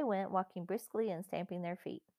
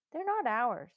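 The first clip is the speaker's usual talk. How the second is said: put-on doubtful boy, raised in pitch and level; hesitant objection in a child's higher voice; 5 semitones higher, 4 dB louder than usual. A narrator's reading. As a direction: object with doubt, a little forcefully. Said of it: "said Peter doubtfully".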